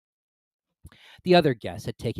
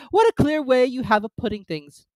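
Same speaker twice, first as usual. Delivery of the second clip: put-on high, loud fussy old duchess; 13 semitones higher, 7 dB louder than usual. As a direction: prattle like a silly duchess. Duchess character voice.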